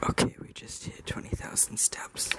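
hushed whisper into the mic. Real, not acted. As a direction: whisper so nobody wakes. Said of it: "I have to whisper into the microphone".

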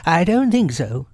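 high, disguised rabbit voice. Acted. Rabbit keeping up his disguise, high character voice. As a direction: deny it in a high put-on voice. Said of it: "said Rabbit".

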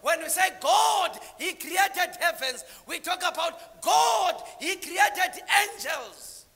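loud, high fervent sermon; preacher in full flow; full-force preaching. Real, not acted. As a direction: drive the story home to the crowd.

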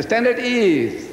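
long, drawn-out drill order, pitched high; drill command. Acted. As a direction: call out a stand-easy, stretching the words. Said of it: "But when you say".

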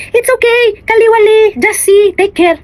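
madam cooing to her child while the sink overflows, high mock voice; mimicking the madam. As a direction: play the doting madam in a high put-on voice.

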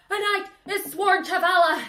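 high, loud mock proclamation; hammed-up yelled echo of the oath; Dennis Quaid. Knight answers King Arthur's oath, high and hammed-up. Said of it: "Dennis Quaid is like".

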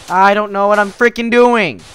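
frustrated outburst; exasperated yell. Real, not acted. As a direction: shout it out at a loss. Frustrated with the building.

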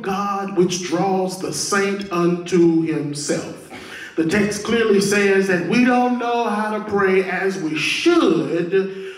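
loud, ringing pulpit delivery; full-force preaching. Real, not acted. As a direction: preach it with force, leaning into key words.

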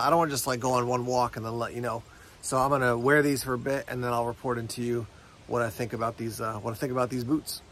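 ordinary talk, nothing marked

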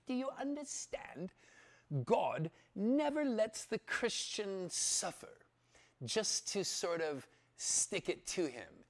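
put-on high, slow, emphatic English accent; put-on English accent, measured and deliberate; Charles Price. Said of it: "I'll do it with his English accent".

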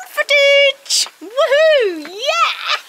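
high, drawn-out cheer; real excited high-pitched hurrah; excited whooping. Real, not acted. Rider cheering about filming gear.

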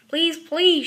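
loud begging cubs; loud, insistent plea; pleading shout. A narrator's reading. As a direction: call it out, begging. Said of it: "shouted the cubs".

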